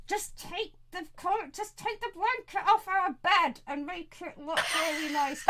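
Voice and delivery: high, urgent hushed instruction; exasperated aside. Acted. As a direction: spell it out to her under strained patience. Exasperated innkeeper instructs woman aside.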